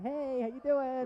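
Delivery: high, slow cheery hello; friendly fan greeting. Retold or imitated. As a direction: greet the fans with a grin. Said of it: "It's like".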